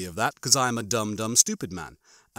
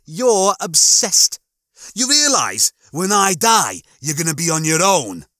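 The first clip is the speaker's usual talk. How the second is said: high, loud, indignant challenge; arguing shipmate; 6 semitones higher, 6 dB louder than usual. Acted. Shipmates quarrel over who will be left alone, indignant. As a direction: push back hard, pitched well up.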